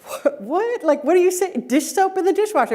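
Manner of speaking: high-pitched, incredulous mock bewilderment; imitating a kid who cannot believe it; baffled teenage son. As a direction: squeak it out in hammed-up disbelief. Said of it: "look on his face was priceless".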